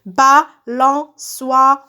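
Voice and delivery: Balançoire very slow, in four separate beats; syllable by syllable. Shown as a demonstration. Teacher cutting words into syllables, syllable by syllable. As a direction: chop Balançoire into BA, LAN, ÇOI, RE. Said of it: "je découpe les mots en syllabes".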